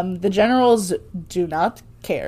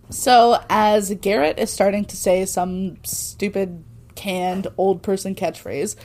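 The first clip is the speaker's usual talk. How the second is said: normal talking voice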